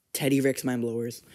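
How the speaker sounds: normal talking voice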